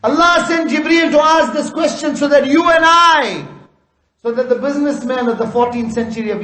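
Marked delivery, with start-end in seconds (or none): 0.0-3.5 preacher's shout. Real, not acted. Rousing climax of a sermon.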